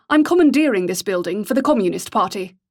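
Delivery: put-on educated English accent, commanding; clipped educated accent. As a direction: announce it like a posh officer. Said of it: "His clipped, educated accent".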